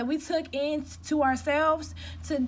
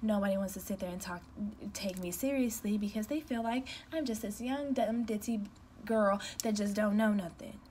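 put-on soft, breathy little-girl voice, quieter and lower; soft sweet voice; 5 semitones lower, 6 dB quieter than usual. Shown as a demonstration. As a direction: play the meek ditzy girl nobody takes seriously. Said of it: "when I sit here and talk like this".

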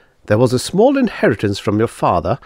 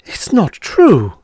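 higher, slow, louder gasped denial; 8 semitones higher, 4 dB louder, much slower than usual. Acted. Miss Twilly hearing her death sentence, gasping in terror. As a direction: force it out in shock and terror. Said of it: "she gasped".

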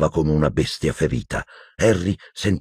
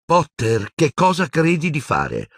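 startled scold; 11 semitones higher than usual. A narrator's reading. Startled nurse scolds Harry.

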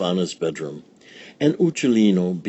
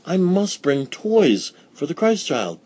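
much higher, eager voice; eager old woman; 9 semitones higher than usual. Acted. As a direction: resolve it eagerly. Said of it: "cried Befana".

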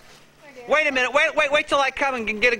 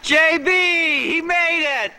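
calling out; 8 semitones higher than usual. Real, not acted. Greeting an arriving friend.

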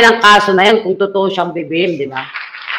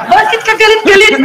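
much higher, sing-song taunt; mock taunt; 11 semitones higher than usual. Host taunting the basher, mock sing-song. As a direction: needle him about his anger.

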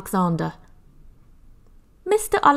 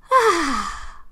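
sigh of relief; 9 semitones higher than usual. Acted. Relief after burp.

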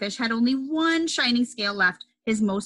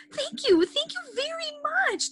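very high bubbly thank you; playful squeaky thanks. Acted. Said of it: "bubbled playfully".

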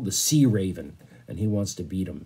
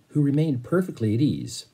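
plain reading voice

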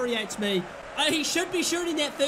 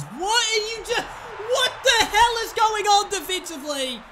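higher and louder, berating the defence; exasperated shouting; 9 semitones higher, 4 dB louder than usual. Real, not acted. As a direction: shout at them in disbelief. Yelling at defenders.

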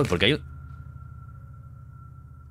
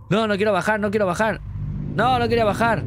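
put-on much higher, whiny-child refusal; protesta infantil; 9 semitones higher than usual. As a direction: read it as a high-pitched refusal to go down. Leyendo la protesta de un personaje. (protesta aguda de niño)

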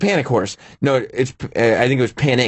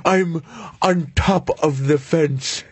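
slow silly voice; slower than usual. Pretend. Voicing the horse in photos.

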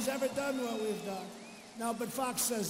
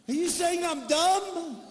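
higher, louder put-on whiny voice of the candidate; mock offended whine; 6 semitones higher, 4 dB louder than usual. Mocking a rival candidate, whiny put-on voice. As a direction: whine it like she was hurt. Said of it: "how about that".

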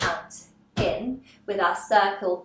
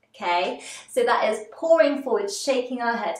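normal talking voice

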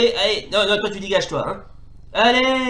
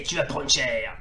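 ordinary delivery

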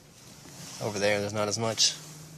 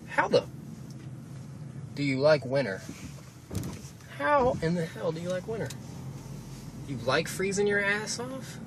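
higher, incredulous rant at winter lovers; exasperated rant; 7 semitones higher than usual. Real, not acted. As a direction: scoff at people who like winter. Ranting at winter fans.

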